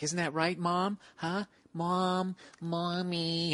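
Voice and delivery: high, creepy-sweet pestering voice; high-pitched imitation of a taunting comic; needling mock child. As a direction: pester her like a child after mommy. Comic needles woman as Mom.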